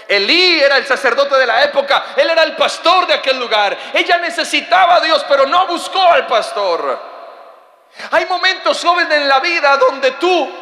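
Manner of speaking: high, loud preacher's cry; fiery preaching. Acted. Preacher to congregation, loud and fiery.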